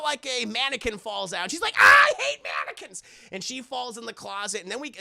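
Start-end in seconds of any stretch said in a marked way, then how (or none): 1.8-3.0 loud startled holler; mock panicked yell. Woman startled by a falling mannequin, loud mock panic. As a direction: scream out as if jumped.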